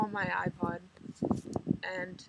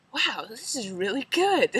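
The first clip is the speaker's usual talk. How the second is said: impressed; 3 semitones higher, 7 dB louder than usual. Retold or imitated. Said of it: "I'll be like".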